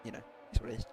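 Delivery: losing his voice; strained, tired hoarse voice. Real, not acted. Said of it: "I'm losing my voice".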